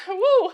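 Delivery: loud, high "woo" of release. Real, not acted. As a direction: let out a high woo to shake off emotion. Shaking off emotion after thanks.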